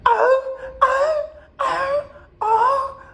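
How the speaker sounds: high-pitched imitated moans; high mock moaning. Imitating the woman's moans to the audience, high mock moaning. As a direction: moan it up high like her.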